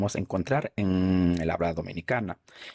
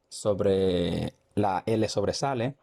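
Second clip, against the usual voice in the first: ordinary talk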